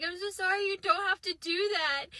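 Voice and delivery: high, put-on crying apology; tearful. Narrator to friend cleaning her room, mock tearful. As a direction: blubber it through mock tears. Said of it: "through my tears".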